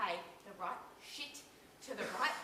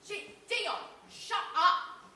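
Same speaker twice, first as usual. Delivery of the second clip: sudden loud higher outburst at him; angry snap; 6 semitones higher, 7 dB louder, much slower than usual. Acted. Wife losing patience with Dion, shouting him down. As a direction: cut him off, furious. Couple quarrel, snapping at partner.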